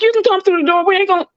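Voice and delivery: high, teasing call to passersby; mocking taunt. Teasing coworkers scared to pass the break room, mocking and high. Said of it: "I'm like".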